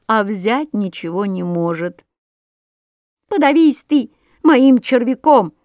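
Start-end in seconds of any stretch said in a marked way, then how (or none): 3.3-5.5 higher, louder angry curse; scolding. A narrator's reading. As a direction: snap it at the ruff. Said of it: "бранился Воробей Воробеич".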